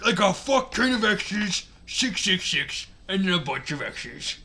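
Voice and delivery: ranting story author. Acted. Story author telling off critical readers, ranting. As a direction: read it as a mouthy, indignant author.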